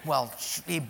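ordinary talk, nothing marked

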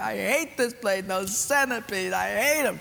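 a hint of a scared little boy; crying child. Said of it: "and I was crying".

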